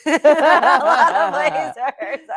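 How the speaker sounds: loud, high, stretched out through laughter; laughing aside. Real, not acted. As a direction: repeat it slowly while cracking up. Laughing over her disguise.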